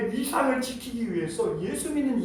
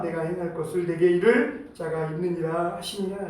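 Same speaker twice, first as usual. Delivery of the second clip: steady, lower, even-toned Bible reading; solemn recitation with little pitch movement; scripture reading; 4 semitones lower than usual. A narrator's reading. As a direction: read the passage out formally in a level, measured monotone. Reads the scripture passage aloud.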